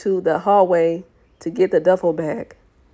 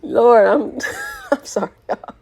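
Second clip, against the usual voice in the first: high-pitched apology breaking into laughter; real helpless laughter; 4 semitones higher than usual. Real, not acted. Reader apologizing to listeners after losing it, laughing. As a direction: crack up while saying sorry.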